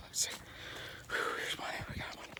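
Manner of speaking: whispered, out of breath; a winded whisper as he spots it; breathless whisper. Pretend. Pretend hiker finding a beer, breathless whisper.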